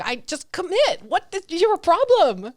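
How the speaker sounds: high, loud exasperated outburst; imitating someone fed up with herself; mock self-berating. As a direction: scold yourself, hammed up. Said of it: "the self-deprecation comes in".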